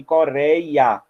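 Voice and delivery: COREIA slowly, stretched syllable by syllable; sílabas destacadas. Shown as a demonstration. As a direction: draw out each syllable of "Coreia". Shows syllables of the word. (Coreia devagar, sílaba por sílaba)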